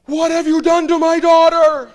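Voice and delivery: high, loud furious scream; screaming father. Retold or imitated. Angry father screaming down the phone at the priest. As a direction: shriek it like an outraged dad. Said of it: "I hear this screaming".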